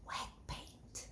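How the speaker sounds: breathy unvoiced whisper. Ruby whispering to Angela in class, hushed whisper. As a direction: whisper it so nobody hears. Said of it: "whispered Ruby".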